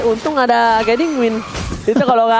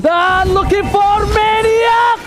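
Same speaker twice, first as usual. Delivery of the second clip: hype shout; 9 semitones higher, much slower than usual. Real, not acted. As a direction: yell it out for the crowd. Shouted call for a maniac.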